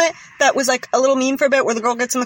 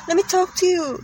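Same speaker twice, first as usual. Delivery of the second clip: imitating; 6 semitones higher, 3 dB quieter than usual. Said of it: "she goes".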